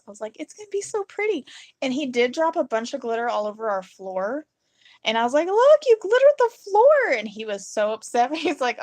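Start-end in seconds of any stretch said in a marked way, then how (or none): 0.4-1.5 high-pitched, dreamy glee; gleeful delight. Retold or imitated. Wife to worried husband about spilled glitter, gleeful delight. 5.5-7.2 very high, loud mock excitement; gleeful teasing. Wife to husband who dropped glitter, gleeful teasing.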